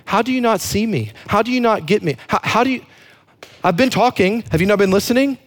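high, affronted mock complaint; pretentious. Offended talker to people who don't get them, high pretentious complaint. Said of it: "we can get real pretentious in how we talk to people".

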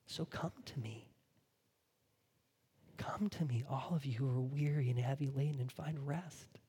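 soft, near-whispered call to come; quiet tender whisper of Jesus; hushed invitation. Acted. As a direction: draw them close with a hush. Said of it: "Do you hear the desire in Jesus' voice?".

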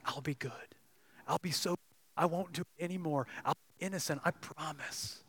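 a hint of a begging little creature; pleading lizard. Lizard begs the man to spare it, soft wheedling plea.

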